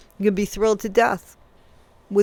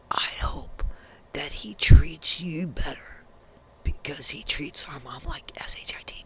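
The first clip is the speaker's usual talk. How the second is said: unvoiced whisper, as a child's secret; hushed whisper; 6 semitones lower, 9 dB quieter than usual. Quoting what the kids said, in a whisper. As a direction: breathe it out like a kid confiding. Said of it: "said to your children".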